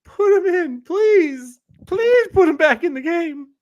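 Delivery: high, loud begging yell; pleading fan. Retold or imitated. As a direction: beg the coach desperately. Mock pleading at TV.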